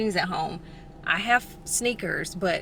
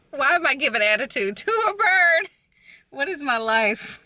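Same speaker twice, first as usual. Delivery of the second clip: amused disbelief; 8 semitones higher, 6 dB louder than usual. Real, not acted. Laughs at herself over bird.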